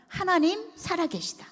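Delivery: high, amazed cry of faith; awed exclamation. Retold or imitated. The grandfather, after weeping, amazed that God lives. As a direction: let it burst out in wonder.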